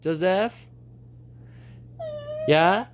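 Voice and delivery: high, sing-song pet talk. Real, not acted. Talking to the parrot.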